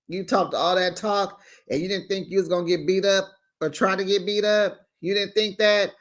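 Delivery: ordinary talk, nothing marked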